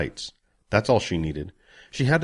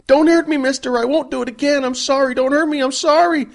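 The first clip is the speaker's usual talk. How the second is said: shrieking much higher and louder, begging for mercy; terrified pleading scream; 8 dB louder than usual. Acted. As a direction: wail it out like a cowering child about to be hit. Jax cowers from raised hand.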